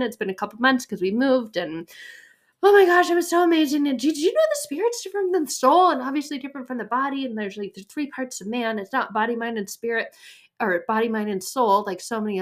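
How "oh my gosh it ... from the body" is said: high, loud, rushed gush; gushing excitement. Retold or imitated. Wife gushing to husband on the drive home, high excited rush. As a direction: gush it fast, high and loud, reliving the moment. Retelling her excited phone call.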